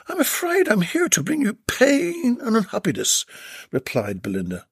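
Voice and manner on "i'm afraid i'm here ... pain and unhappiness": high, mock-dramatic lady; a teasing grave warning; Belinda's voice. Belinda to Jed, high mock-grave voice.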